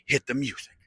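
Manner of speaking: ordinary delivery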